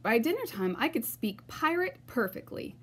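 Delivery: ordinary delivery, nothing marked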